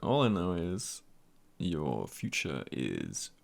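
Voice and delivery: slow, low, drawn-out mystic voice; mock fortune teller. To a friend, in shades, mock fortune teller prophecy. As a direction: play the mysterious seer, stretching every word.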